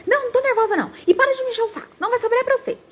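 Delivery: put-on high, exasperated mum snapping at the kid; mock irritated mum threat; irritated mother. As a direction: imitate a mother losing patience. Irritated mother snaps at child.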